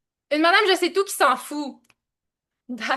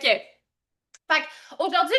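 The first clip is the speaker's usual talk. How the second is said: ordinary talk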